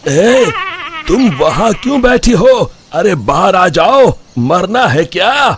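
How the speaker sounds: high shouted warning across water; calling out. Acted. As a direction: call her out of the stream. Man calls to woman in river.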